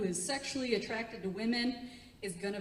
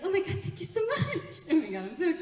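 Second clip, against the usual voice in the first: high, giggly mock gratitude; hammed-up girlish gushing; gushing thanks; 6 semitones higher than usual.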